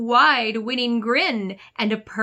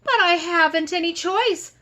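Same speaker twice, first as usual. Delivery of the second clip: higher, louder protesting fish; high whiny fish; 8 semitones higher, 5 dB louder than usual. Acted. Fish whines back to the squid, higher and louder. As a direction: protest that you can't help it.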